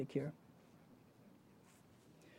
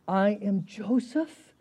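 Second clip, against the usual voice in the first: slow, measured declaration at moderate volume; drawn-out, restrained announcement of identity; slow reveal; much slower than usual. Acted. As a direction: reveal who you are slowly, without shouting. Rereads the reveal more gently.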